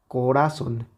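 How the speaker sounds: "corazón" stressed on "ra", weak final "zon"; sin fuerza en la última. Shown as a demonstration. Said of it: "si quitamos la intensidad a la última sílaba". ("corazón" con el golpe en "ra", final débil)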